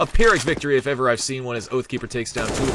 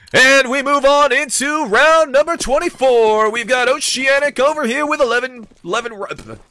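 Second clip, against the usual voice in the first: game show announcer voice; 10 semitones higher, 7 dB louder than usual. Shown as a demonstration. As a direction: announce it like a TV quiz host. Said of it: "the game show announcer voice was like".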